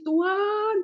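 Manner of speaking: high, pleading retold prayer with tears; tearful prayer. Wife praying to God about her husband, tearful pleading. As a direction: beg God through half-acted tears. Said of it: "menangis dan menangis".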